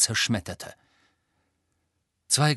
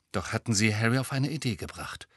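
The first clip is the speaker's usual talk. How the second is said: ordinary delivery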